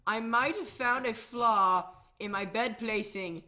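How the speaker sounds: normal talking voice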